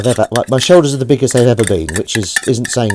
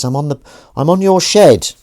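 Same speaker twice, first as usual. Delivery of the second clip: higher, louder acted voice; calling back; 5 semitones higher, 5 dB louder than usual. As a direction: call back from across the garden. Unseen cat voice calls back.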